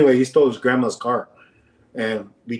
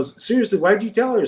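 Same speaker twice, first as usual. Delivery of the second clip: higher-pitched reenacted disbelief; retold exasperated demand; 8 semitones higher than usual. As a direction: press a friend for an answer, pitched up. Presses friend in retold quarrel.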